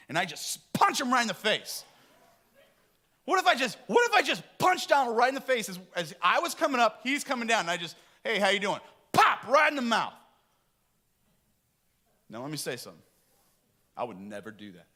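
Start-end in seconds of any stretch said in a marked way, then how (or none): 0.1-10.1 excited mock fantasy. Mock fantasy of punching Donald.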